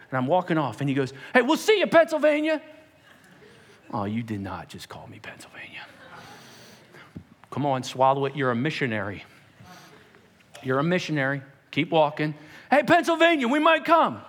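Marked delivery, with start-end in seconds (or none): 1.3-2.6 high-pitched imitated teen holler; calling after someone. Teen calls after departing speaker. 3.9-5.9 low, whispered aside to himself; muttered disbelief. Retold or imitated. Muttered reaction to the nickname. 12.7-14.2 high, loud imitated teen shout; calling out. Teen shouts after departing speaker.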